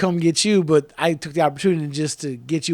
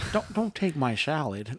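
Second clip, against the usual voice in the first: soft, slow, put-on actor voice; Owen Wilson; 3 semitones lower, 8 dB quieter, slower than usual. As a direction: plead softly and slowly in a celebrity impression. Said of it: "my best Owen Wilson voice".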